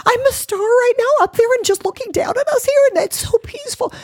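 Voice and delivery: very high, laughing squeal; squeaky, elated acting-out; giddy wonder. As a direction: gush it like a secret to passing strangers. Imagined giddy address to strangers on street.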